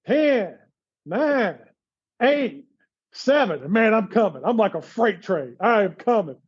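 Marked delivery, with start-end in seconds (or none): 0.1-3.6 whiny coach countdown. Retold or imitated. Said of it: "that whiny voice".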